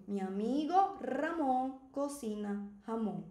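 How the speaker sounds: slow, long rolled R in "Ramon", H sound in "jamón"; R versus J. Shown as a demonstration. Teacher repeats the example for students, R against J. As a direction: separate the trilled "Ramon" from breathy "jamón". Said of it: "Tá vendo?".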